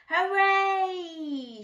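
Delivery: loud high cheer; loud joyful cheer; shouted. Acted. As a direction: cheer it out together. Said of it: "shouted Little Beaver".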